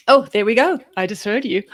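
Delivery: ordinary talk, nothing marked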